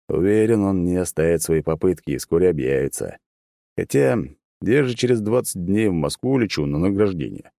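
plain reading voice

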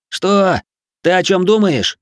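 loud, high-pitched challenge; bewildered shout. A narrator's reading. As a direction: shout back in confusion. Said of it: "выкрикнул, не понимая".

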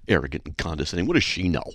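ordinary delivery

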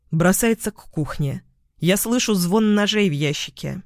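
ordinary delivery, nothing marked

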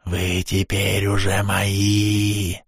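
slow, gurgling spectral voice; gurgling ghost. Acted. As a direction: bubble the words out like a drowned spirit. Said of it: "пробулькал призрак белой горячки".

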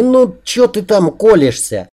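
high, peeved question; indignant complaint. A narrator's reading. He protests to her about the pins, high and indignant. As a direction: whine up high at being pricked.

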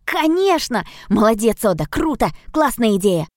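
high, loud enthusiastic praise; excited. Acted. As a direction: cheer the idea on. Nemo cheers Oda's idea.

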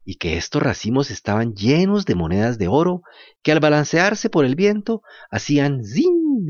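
ordinary delivery, nothing marked

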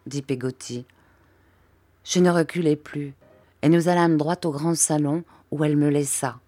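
ordinary delivery, nothing marked

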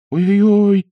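high wail of terror. A narrator's reading. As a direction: wail it out in horror. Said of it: "завопил в ужасе Пиноккио".

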